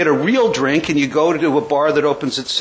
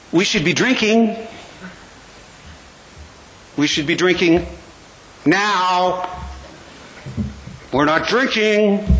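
a lightly voiced inner tempter urging to drink; voice of the craving mind. The alcoholic mind nagging its owner, lightly voiced tempter. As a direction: coax yourself toward a drink, half mocking. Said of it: "the mind goes like this. It goes".

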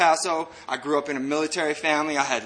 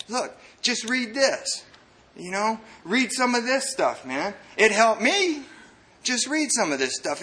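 higher-pitched mock smart-guy voice; lightly voiced know-it-all, pitched up; scholar role; 6 semitones higher than usual. Smart guy handing books to struggling man, higher-pitched scholar voice. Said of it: "scholar man, smart guy".

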